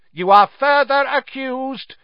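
loud, high accusing bellow. A narrator's reading. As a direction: bellow the charge over the screams. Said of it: "bellowed Mister Crouch".

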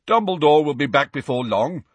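put-on boy's confident voice; confident Ernie. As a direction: say it with full schoolboy confidence. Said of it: "said Ernie Macmillan confidently".

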